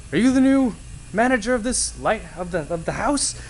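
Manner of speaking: high, loud put-on sailor voice; annoyed sailor. Sailor confronting Karen at the lighthouse door, high loud voice. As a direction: play a cross sailor hollering at the door.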